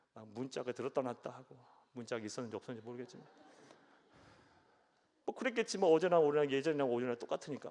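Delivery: ordinary talk, nothing marked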